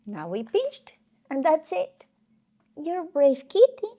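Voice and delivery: high-pitched pet talk; cooing to pet. Real, not acted. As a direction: praise the cat in a high, lilting coo. Soothing the cat during a jab.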